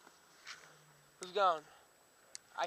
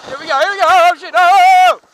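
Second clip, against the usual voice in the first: excited yelling; 7 semitones higher, 17 dB louder than usual. Real, not acted. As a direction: shout into the drop. Heading into jump, yelling.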